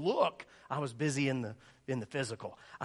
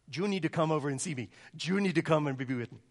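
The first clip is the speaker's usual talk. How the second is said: put-on Puerto Rican accent; 4 dB louder than usual. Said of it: "She's from Puerto Rico".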